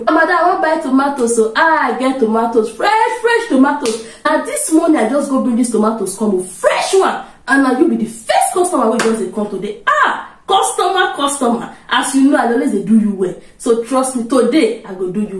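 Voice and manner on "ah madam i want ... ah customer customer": high, eager Nigerian market seller pitch; pushy market seller. Retold or imitated. Nigerian trader pitching tomatoes to a customer, eager and high. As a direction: sell your tomatoes hard, higher and excited. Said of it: "Let me give you the setting".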